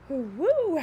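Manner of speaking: loud, high whoo after choking; relieved exhale. Real, not acted. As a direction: blow out a relieved woo as breath returns. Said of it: "now that I got my voice back".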